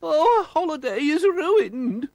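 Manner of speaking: high, slow, wailing woman's voice; wailing lady. A narrator's reading. Missus Bumblebee lamenting the broken car, high wail. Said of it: "wailed Missus Bumblebee".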